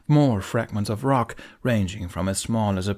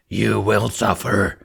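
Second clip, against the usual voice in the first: slow, much louder monstrous threat; demon threat; 6 dB louder, slower than usual. A narrator's reading. Demon threatening the humans, slow booming threat. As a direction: draw each word out like doom. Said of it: "the demon screamed".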